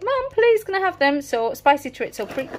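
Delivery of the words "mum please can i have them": high-pitched imitation of a begging kid; pleading child. Child begs mum for the reduced treat, pleading. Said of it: "Dylan was like".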